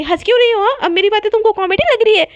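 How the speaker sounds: loud, high indignant questioning. Acted. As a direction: challenge someone for laughing at you. Acted jealous scolding of laughing friend.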